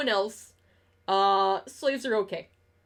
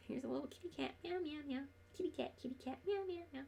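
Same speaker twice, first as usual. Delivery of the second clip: cooing at the cat; 4 semitones higher, 16 dB quieter, much faster than usual. Real, not acted. Streamer coos to her cat on stream, soft high baby talk. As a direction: coo over the cat, meowing at it.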